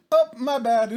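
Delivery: loud, high mock apology; overly apologetic. Shopper squeezing between arguers, overly apologetic. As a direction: blurt it out high as if bumping someone. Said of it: "Just being overly apologetic for no reason".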